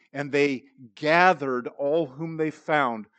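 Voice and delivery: slow, with "gathered" leaned on hard; preacher stressing "gathered" for the congregation. Shown as a demonstration. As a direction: hit the word "gathered" and take it slowly. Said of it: "I emphasize that word".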